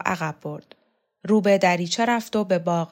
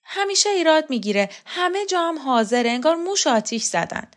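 high-pitched, louder grumbling outburst; interrupting complaint; 9 semitones higher, 4 dB louder than usual. A narrator's reading. Rokhsareh cuts Teymur off to complain, high and exasperated. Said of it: "حرف تیمور را قطع کرد".

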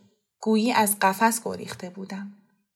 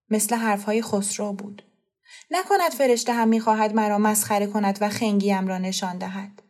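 usual speaking voice

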